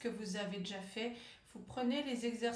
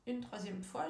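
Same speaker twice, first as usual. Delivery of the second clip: ordinary talk, nothing marked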